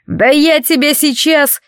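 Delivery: blurted out in a slow, high rage; angry outburst. A narrator's reading. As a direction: spit the threat out. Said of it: "выпалил трактирщик". (гневный выкрик)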